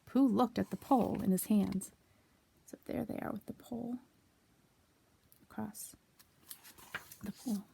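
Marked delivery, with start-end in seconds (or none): 2.7-7.6 put-on soft, breathy whisper to the child; whispered aside. A narrator's reading. Reader whispers the scene to a child listener. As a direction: lean in and whisper the secret.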